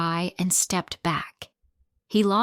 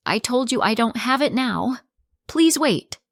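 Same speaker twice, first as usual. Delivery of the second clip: higher and louder, rising into a frightened plea; desperate plea; 6 semitones higher, 4 dB louder than usual. Acted. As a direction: beg him to wait, voice climbing. Mother pleads with landlord.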